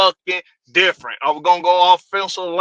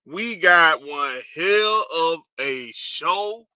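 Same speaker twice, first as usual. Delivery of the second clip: normal talking voice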